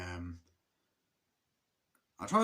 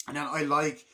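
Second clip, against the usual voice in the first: usual speaking voice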